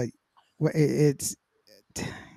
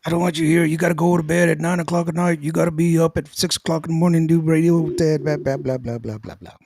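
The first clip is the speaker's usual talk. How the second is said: lightly voiced manager rattling off rules, slightly higher; imitated nagging manager; 4 semitones higher than usual. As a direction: mimic a nagging boss, trailing into blahs. Retold manager lecturing the young artists.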